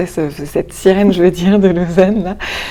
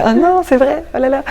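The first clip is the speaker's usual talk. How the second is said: surprised; 8 semitones higher, 4 dB louder than usual. Retold or imitated. Character surprised at last-minute invitation.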